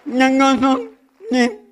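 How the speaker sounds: high, loud laughing denial; a real amused protest. Real, not acted. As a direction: wave off the laughter, pitching up. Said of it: "No me burlo yo".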